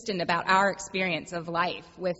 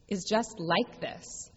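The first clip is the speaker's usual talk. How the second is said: a calm mantra, softer and slower; soft, slow refrain; 4 dB quieter, slower than usual. Shown as a demonstration. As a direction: let the phrase settle gently and slowly. Refrain in the sermon.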